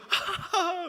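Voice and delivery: high, nervous mock giggle breaking out; sudden high imitated laugh. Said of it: "갑자기 막".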